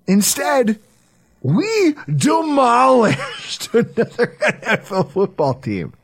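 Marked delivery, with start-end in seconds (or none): 1.4-5.9 laughing through a gleeful boast. Real, not acted. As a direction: break into laughter mid-brag.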